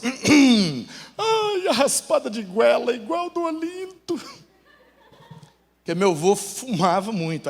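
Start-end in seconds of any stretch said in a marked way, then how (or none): 0.0-4.2 high, drawn-out sentimental wail; mock tearful grandma. Grandmother reminded of her husband's throat scrape, mock tearful wail. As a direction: imitate a grandmother tearing up over a memory. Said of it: "minha avó chega a chorar".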